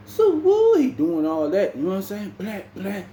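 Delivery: high yelp, then slow hype; mock hype. New inmate shows off gang signs, high whooping. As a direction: ham up the gang display. Describing gang member's display.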